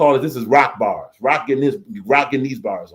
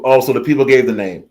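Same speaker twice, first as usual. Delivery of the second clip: ordinary delivery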